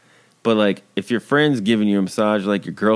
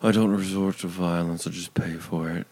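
soft, lower-pitched superior voice; aloof rich man; 5 semitones lower, 7 dB quieter than usual. Acted. Peter too good for violence, aloof murmur. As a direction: say it quietly, above it all.

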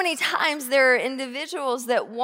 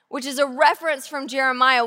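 ordinary talk

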